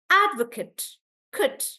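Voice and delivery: "advocate" as AD-vuh-kut, then "kut" alone; strong AD, then show the short "kut"; reduced final syllable. Shown as a demonstration. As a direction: end "advocate" on kut, never Kate. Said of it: "this Kate becomes like kut".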